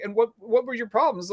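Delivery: parroting the clerk; mocking retort. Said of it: "I was like".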